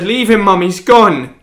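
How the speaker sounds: desperate, pulling mum away; retold shout. Son retells shouting at his mum over the body. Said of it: "And I said".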